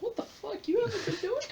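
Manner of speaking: squeaky high-pitched disbelief; mock baffled squeal. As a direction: squeal it like a shocked relative. Imitating puzzled onlooker.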